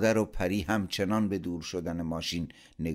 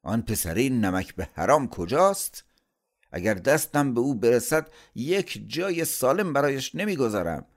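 raised, loud angry threats; aggressive; 7 semitones higher, 6 dB louder than usual. A narrator's reading. Furious uncle hunting for his nephew, aggressive. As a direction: demand the culprit, voice up and forceful. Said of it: "با پرخاش به او گفت".

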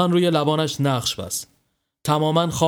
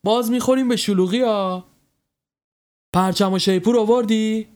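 impatient call-out; 7 semitones higher than usual. A narrator's reading. Sohrab hurrying his late friend from the car, impatient shout. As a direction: call out impatiently, pitched well up. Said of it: "سهراب فریاد زد".

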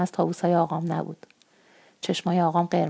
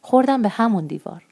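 plain reading voice

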